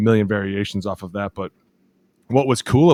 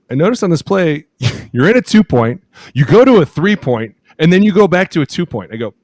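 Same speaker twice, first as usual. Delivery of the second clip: higher, louder coach correcting with a laugh; amused scolding; 8 semitones higher, 6 dB louder than usual. Retold or imitated. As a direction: tell him it's illegal, laughing.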